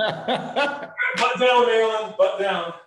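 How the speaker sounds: high, loud drill-sergeant bark of butt down; mock coach yell. Teasing his friend by shouting the viewer's order back. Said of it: "Mark Maryland said, "Butt down".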